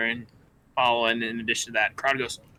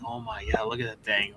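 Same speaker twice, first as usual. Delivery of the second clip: hushed, breathy crowd murmur; awed townsfolk whispering; 4 semitones lower, 5 dB quieter than usual. Acted. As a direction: whisper it like a gawking bystander. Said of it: "whisper, like".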